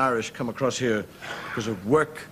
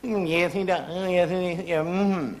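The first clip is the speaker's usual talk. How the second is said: higher, prim gossiping lady voice; a lightly voiced gossiping lady; gossiping woman; 6 semitones higher than usual. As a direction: purse your lips and chatter like a neighbour. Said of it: "two women talking to one another".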